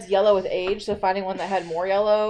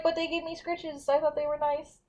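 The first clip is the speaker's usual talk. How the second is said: higher, softer little pet voice; pet's imagined voice; 5 semitones higher, 6 dB quieter than usual. Acted. Host voices Chewy defending the leprechauns, sweetly pleading. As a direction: plead innocence as the accused pet. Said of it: "You're like".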